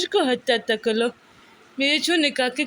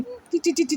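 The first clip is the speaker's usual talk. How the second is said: put-on baby cooing sounds; 4 semitones higher than usual. Mother cooing playful sounds to baby.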